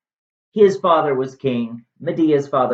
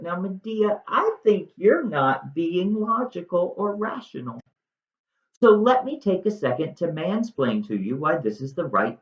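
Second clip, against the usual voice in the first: higher, patronising mansplainer tone; condescending Jason; 7 semitones higher than usual. Retold or imitated. Jason mansplains to Medea, higher and patronising. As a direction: talk down to her like a smug husband.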